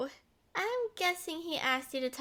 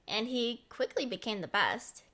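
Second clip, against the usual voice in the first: usual speaking voice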